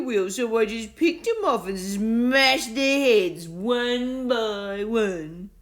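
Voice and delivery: very slow, loud mock-pity; mocking bully. A narrator's reading. Bully mocking Will's lost pieces, slow and sneering. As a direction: drag out the fake sympathy. Bartholomew keeps taunting.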